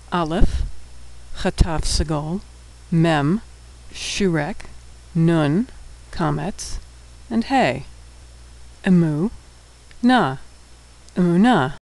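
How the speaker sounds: letter by letter, then syllable by syllable; sounding out. Shown as a demonstration. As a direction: spell it out with pauses, then say the whole word. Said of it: "we'll be sounding out all of the words".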